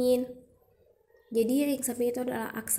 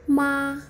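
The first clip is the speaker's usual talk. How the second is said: "ma" held high and level, a bit louder; level first tone; 3 semitones higher, 6 dB louder than usual. Shown as a demonstration. Teacher showing beginners the first Mandarin tone, high level "ma". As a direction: say "ma" on one steady high note. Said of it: "intonasi datar adalah".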